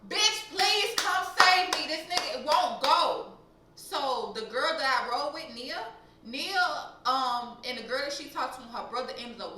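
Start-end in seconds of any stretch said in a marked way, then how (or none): 0.1-3.4 loud, high, drawn-out call for rescue; desperate plea. Retold or imitated. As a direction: call out slowly and loudly for a friend's help. Begs friend to rescue her.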